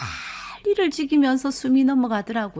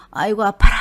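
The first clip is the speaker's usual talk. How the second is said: a loud groan of pain as she picks up the phone; 9 dB louder, faster than usual. Retold or imitated. Answering her brother's call, a loud pained groan. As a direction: blurt out the ouch before the hello. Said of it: "먼저 입에서 이렇게 나와서".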